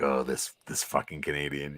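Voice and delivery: low, soft mock grumbling; mock grumble. Professor silently cursing his teammate, imitated soft grumble. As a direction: grumble under your breath in pretend exasperation. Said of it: "like, grumble".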